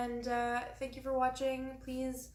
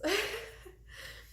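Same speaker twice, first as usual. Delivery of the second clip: nervous laugh; 10 semitones higher, 3 dB quieter than usual. Real, not acted. Nervous laugh after hiccups.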